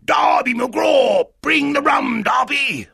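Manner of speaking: high, loud, drawn-out holler; eerie long-distance shout; shouting from far away. A narrator's reading. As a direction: call out as a ghostly captain demanding service. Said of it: "it shouted from far away".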